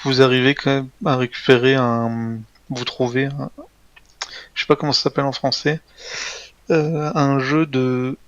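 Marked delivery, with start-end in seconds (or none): none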